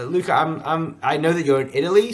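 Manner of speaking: ordinary talk, nothing marked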